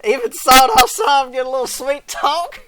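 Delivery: high, loud mock-coy question; playful plea. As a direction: pipe it up hopefully, hamming it up. Playfully begging for sweet talk.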